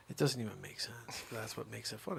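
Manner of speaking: normal talking voice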